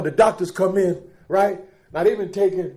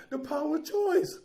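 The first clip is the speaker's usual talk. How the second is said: ordinary talk, nothing marked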